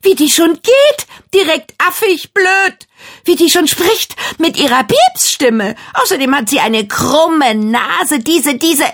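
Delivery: furious ranting. A narrator's reading. Girl hurls insults at mirror.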